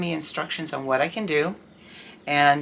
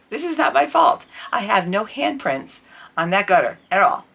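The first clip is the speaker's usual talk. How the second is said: raised, louder indignant retort; retold angry retort; 5 semitones higher, 6 dB louder than usual. Telling the neighbor off over the flooded gutter, indignant and raised. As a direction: throw the blame back at him, loudly.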